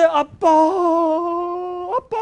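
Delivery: put-on child crying. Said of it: "우는데".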